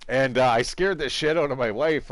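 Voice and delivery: usual speaking voice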